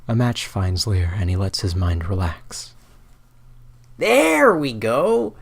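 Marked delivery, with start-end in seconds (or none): 4.0-5.3 high, slow relieved drawn-out tone; relieved sigh. Acted. Lear relaxing as the match finds him, high and relieved. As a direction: let the relief flood out slowly. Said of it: "he says aloud".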